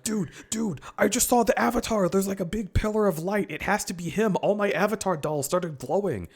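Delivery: high, fast excited Zuko; excited mock Zuko. Zuko telling Iroh about the light, high and giddy. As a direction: gush it like a giddy kid. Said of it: "and Zuko's like".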